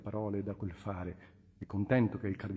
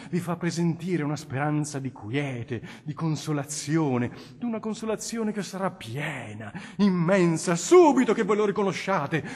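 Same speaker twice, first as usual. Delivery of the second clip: loud, much higher fervent preaching; impassioned plea; 6 semitones higher, 11 dB louder than usual. Acted. The cardinal urges the nobleman toward God in a loud, fervent plea. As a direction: pour out the call to faith with burning zeal.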